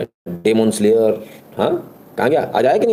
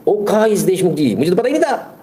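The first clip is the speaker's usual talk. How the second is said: much higher, exaggerated astonishment; mock surprise; 7 semitones higher than usual. Vlogger mocks the employer's ignorance, high fake shock. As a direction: act shocked at the news.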